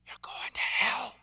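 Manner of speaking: leaned-in whisper. Retold or imitated. Seminary student leaning in to whisper to the pastor. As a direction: whisper it close to his ear. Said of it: "leans in and he goes".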